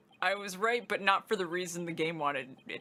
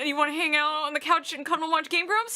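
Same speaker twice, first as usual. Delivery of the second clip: imitating eager partner; 6 semitones higher, 4 dB louder than usual. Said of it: "And you're like".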